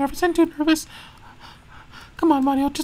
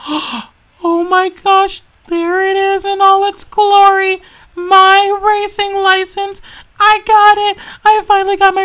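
slow, louder awestruck gasp; awed delight; 2 semitones higher, 6 dB louder, slower than usual. Acted. Mario finding his racing license, awed and thrilled. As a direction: savour the discovery.

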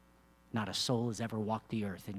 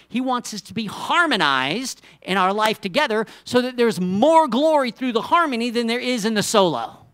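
loud, high, rising sermon voice; preaching at full force; 8 semitones higher, 10 dB louder than usual. Acted. Preacher rousing the church about harmony, full force. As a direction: preach it out to the whole congregation.

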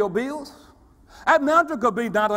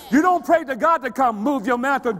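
ordinary talk, nothing marked

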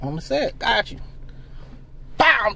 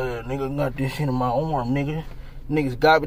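low, soft mock victim voice; low, quiet imitation of a shot man; mock Hassan; 9 semitones lower, 7 dB quieter than usual. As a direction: whine it low like you're playing hurt. Mocking Hassan after being shot.

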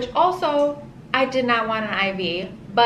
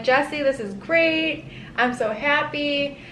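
high, slow, dreamy contentment; blissed out; 6 semitones higher, slower than usual. Retold or imitated. To Jesse once the epidural kicks in, higher and slow with delight. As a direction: float on the painkiller high.

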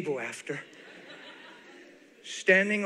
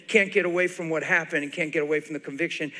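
normal talking voice

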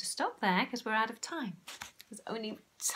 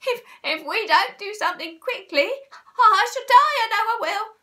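high, stammering, climbing wail; panicked scream; 12 semitones higher, 14 dB louder than usual. A narrator's reading. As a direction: shriek as if death is near. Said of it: "screamed Missus Twit".

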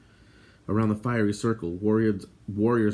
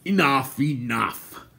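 raised call, easing on the repeat; 4 semitones higher, 3 dB louder, slower than usual. A narrator's reading. Gwydion stopping Terran shaking the creature, calling out. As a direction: call a halt, firmly. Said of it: "Gwydion called".

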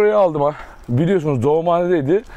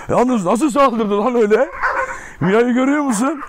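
surprised; 5 semitones higher than usual. Real, not acted. After a surprise dog attack.

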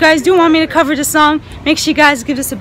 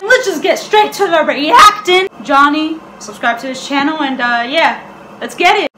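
normal talking voice

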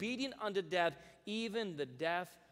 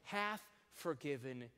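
slow, solemn verse reading; slow scripture reading; 3 semitones higher than usual. Acted. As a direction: let each phrase land slowly.

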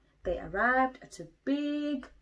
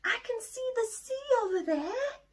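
excited shout; 3 dB louder than usual. Acted. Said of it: "Little Bear shouted".

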